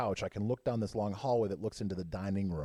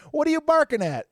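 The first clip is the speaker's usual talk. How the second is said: much higher and louder, scolding; scolding shout; 9 dB louder than usual. Retold or imitated. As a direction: yell it at the dog. Scolding the dog.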